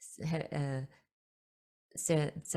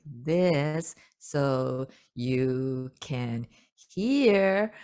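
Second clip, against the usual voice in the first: stretched slow speech, louder at the end; very slow and drawn out; 8 dB louder, much slower than usual. Shown as a demonstration. Spirits slowing speech for humans, dragged very slow. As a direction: drag every word out like a slowed recording. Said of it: "they have to talk like".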